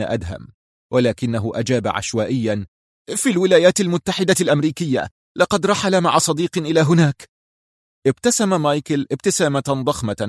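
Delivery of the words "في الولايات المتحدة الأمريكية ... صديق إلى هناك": high, hurried frightened blurting; high-pitched anxious servant; panicked answer. A narrator's reading. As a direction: blurt it out fast to save your life. Terrified servant blurts random answer.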